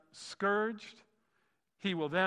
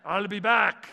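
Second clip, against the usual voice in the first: loud, high imitation of an Austrian accent; 4 semitones higher, 12 dB louder, slower than usual. Preacher quoting a famous movie line, in an Austrian accent. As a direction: punch it out like an Austrian action star. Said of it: "He didn't say it with an Austrian accent".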